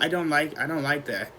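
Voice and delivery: soft, subdued conclusion; quiet dislike. Retold or imitated. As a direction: murmur it softly, put off. Retold realisation about being high.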